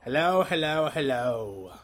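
hammed-up sing-song hello; goofy character hello; weird voice greeting. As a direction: greet them in a silly put-on voice. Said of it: "What the hell was that?".

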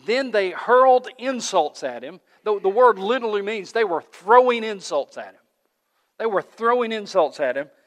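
preacher building fire over the insults; loud preaching. Real, not acted. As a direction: drive it home with force. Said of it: "preacher builds in intensity".

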